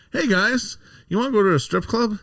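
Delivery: put-on high voice, luring passers-by; old tout's come-on. Old guys tout a strip club in Vegas, high mock come-on.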